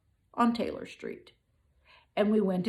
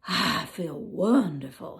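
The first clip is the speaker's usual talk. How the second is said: contented lion; 3 dB louder than usual. Acted. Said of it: "he said".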